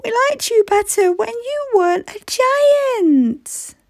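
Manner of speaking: high, whiny friend voice; friends complaining. Acted. Friends moan at giant Ellie, high and whiny. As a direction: moan at her like let-down friends. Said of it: "they cried".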